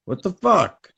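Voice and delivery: a mobster jumping out of a car after a rear-ending; hammed-up wiseguy yelp; Gotti. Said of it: "Gotti gets out and he goes".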